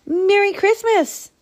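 very high festive rabbit call; high-pitched rabbit shout; repeated shout. A narrator's reading. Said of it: "repeated Rabbit".

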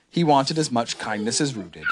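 plain reading voice

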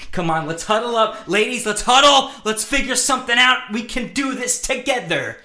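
high, rousing team huddle call; rally them like a team captain; mock pep talk. Calling the ladies into a huddle, mock rousing pep talk.

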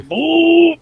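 long, high-pitched boom; imitated boom. Storyteller showing the boat punching free, imitated boom. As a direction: draw the word out high like a whoosh.

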